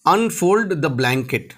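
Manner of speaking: very slow, word by word; slow model phrase. Shown as a demonstration. Teacher slowly models English for Hindi learners. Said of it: "teacher models English phrase".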